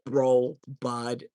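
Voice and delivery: a lightly voiced guy calling a woman bud; mock dude voice. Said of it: "You don't go up to a lady and go".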